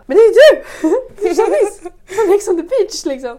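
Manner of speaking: high, loud starstruck squeal with laughter; recognising a reality star, fangirling loudly; fangirl excitement. Retold or imitated. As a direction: gush like a starstruck fan. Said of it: "Verkligen såhär fangirl".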